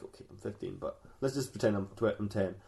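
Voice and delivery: low, soft backing down; sheepish mock admission. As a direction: concede it quietly, then keep pretending. [name] admits his real age.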